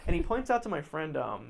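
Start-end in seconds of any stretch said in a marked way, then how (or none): none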